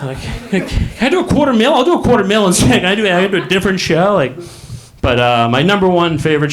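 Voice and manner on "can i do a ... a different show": high, hurried haggling; anxious bargaining. Retold or imitated. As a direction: scramble for a way out, comically. Winner bargains to escape the prize.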